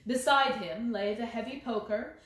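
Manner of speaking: ordinary delivery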